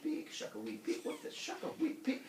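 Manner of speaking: high whispered peeking; sneaky whisper. Retold or imitated.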